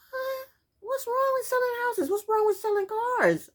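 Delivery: very high-pitched squeal of disbelief; exasperated high-pitched questioning; incredulous. Real, not acted. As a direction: squeak out your bafflement, way up high. Baffled by the insult she quoted.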